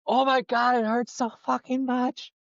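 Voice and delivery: mock pained wail. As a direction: whine it through the pain. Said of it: "she's like".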